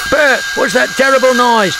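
high, alarmed cry; frightened man calling to his mate; terrified cry. A narrator's reading. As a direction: cry out in fright, pitched right up. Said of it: "cried the first man, terrified".

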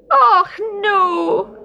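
high, alarmed cry. Acted. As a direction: cry out in dismay. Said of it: "he cried".

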